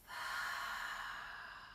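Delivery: long soft exhale through the mouth; sighing out. Shown as a demonstration. Yoga teacher showing the class how to breathe out, long sigh. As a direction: breathe out in a slow, airy sigh. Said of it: "sighing out through the mouth".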